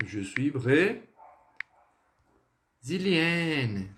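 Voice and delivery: "brésilienne" broken after the first syllable, then stretched; feminine pronunciation. Shown as a demonstration. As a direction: model "brésilienne" slowly with a clear N ending. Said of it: "O feminino vai ficar assim".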